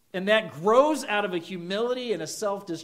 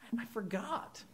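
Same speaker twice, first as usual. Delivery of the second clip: put-on higher, softer little kid excuse; sheepish kid; 5 semitones higher, 10 dB quieter than usual. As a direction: mumble it like a caught child. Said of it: "Or the best one".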